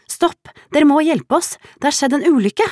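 high, loud cry for help; desperate shout. A narrator's reading. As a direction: scream for help in panic. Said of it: "ropte hun fortvilet".

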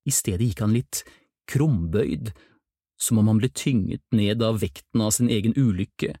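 ordinary delivery, nothing marked

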